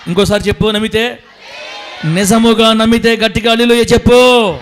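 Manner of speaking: loud call for hallelujah; shouting to the church. Real, not acted. Pastor urging congregation to shout.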